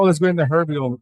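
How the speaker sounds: usual speaking voice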